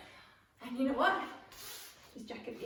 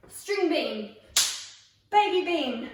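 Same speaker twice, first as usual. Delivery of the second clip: loud, high game commands; called out; 13 semitones higher, 7 dB louder than usual. Shown as a demonstration. Leader calls beans for players to act, called out loud and high. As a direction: stretch and belt out each name. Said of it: "when I call a string bean".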